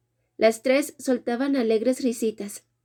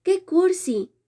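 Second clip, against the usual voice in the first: higher-pitched scoff; mocking exclamation; 7 semitones higher, slower than usual. A narrator's reading. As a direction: scoff at the soppy letter, pitched up. Mocking friend reads love letter.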